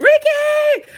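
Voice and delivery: high, loud, slow wail of the name; anguished cry. Real, not acted. Fan mourning a shot athlete, loud anguished cry. Grief-shock naming the character.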